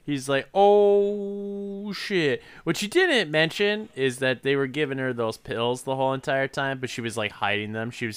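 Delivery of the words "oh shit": high, long drawn-out 'oh'; hammed-up dawning dismay; mock dismay. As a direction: stretch it into a high, comic oh-no reaction. Acted dismayed reaction in retelling.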